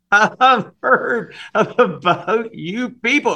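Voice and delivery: high, loud, drawn-out freak-out; hammed-up horror in a put-on high voice; mock alarm. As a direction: loudly overplay a wary recoil.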